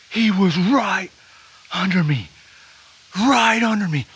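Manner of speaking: just shot the biggest buck of his life, ecstatic; real adrenaline rush. Real, not acted. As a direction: blurt it out, overwhelmed with joy. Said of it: "I gotta calm down".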